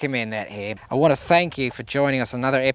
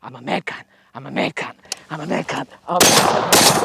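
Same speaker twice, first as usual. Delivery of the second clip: self-hype mantra. Real, not acted. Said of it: "and I told you I am".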